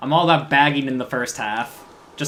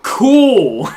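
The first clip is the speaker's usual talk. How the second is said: high loud exclaim; loud surprised cool; 10 semitones higher, 11 dB louder than usual. Real, not acted. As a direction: burst out in surprise. Reacting to odd team name.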